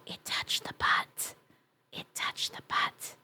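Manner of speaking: slow soft whisper. Pretend. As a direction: breathe it as a secret. Whispered joke repeated.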